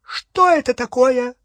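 high, loud, shocked demand; shrill startled question; alarmed shriek. A narrator's reading. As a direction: cry out in horror at the sight. Bellatrix sees the sword, alarmed.

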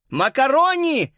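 high, long-drawn call. A narrator's reading. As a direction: call the dog through a hole. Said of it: "позвал он в дыру".

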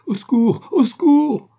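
high, loud scream for help; full-force cry. A narrator's reading. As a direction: shout desperately for rescue. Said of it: "cria de toutes ses forces". (cri de toutes ses forces)

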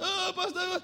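high, mock-tearful whine; imitated crying. Girl coming back to her pastor, mock crying. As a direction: whimper it like a sobbing girl, put on.

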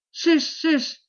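high-pitched chase cry. A narrator's reading. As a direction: call the men on to the pursuit. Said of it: "criaient Conan". (cri de poursuite, voix haut perchée)

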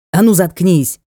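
sharp loud snap; barked order. A narrator's reading. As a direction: bark it to shut him up. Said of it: "рявкнул рыцарь".

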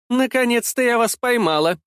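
high-pitched, unceremonious outcry; rude shout. A narrator's reading. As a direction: cry it out rudely at a cornered rival. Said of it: "крикнула она грубым голосом".

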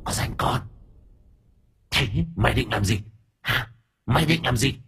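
put-on soft, menacing old woman; menacing spirit. A narrator's reading. Possessed old woman challenging Thơm, soft menacing voice. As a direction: threaten her quietly.

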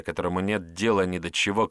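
usual speaking voice

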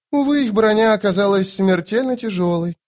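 high-voiced lady with mild regret; regretful. A narrator's reading. Lady Envy looking at the bodies in the pools, regretful. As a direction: lament lightly in a high voice. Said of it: "сказала Зависть с сожалением".

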